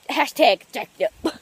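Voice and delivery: slow, loud mock-serious tag; playful hashtag tagline; jokey hashtag. Real, not acted. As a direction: drag it out as a punchline.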